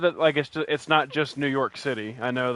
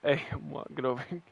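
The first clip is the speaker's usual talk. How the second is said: Boston accent; 2 semitones higher, 6 dB quieter than usual. Shown as a demonstration. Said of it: "pretty good with a Boston accent".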